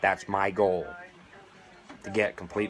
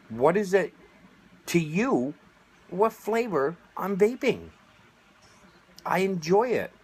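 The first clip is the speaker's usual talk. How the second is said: indignant; 10 semitones higher than usual. Real, not acted. Indignant outburst at critics.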